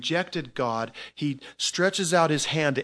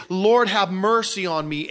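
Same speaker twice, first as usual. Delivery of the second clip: pleading prayer; 7 semitones higher, 7 dB louder, slower than usual. Acted. Sinner calling on the Lord.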